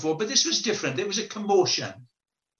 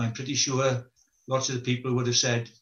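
ordinary talk, nothing marked

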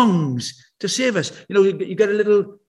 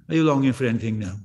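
ordinary delivery

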